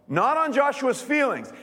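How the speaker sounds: ordinary delivery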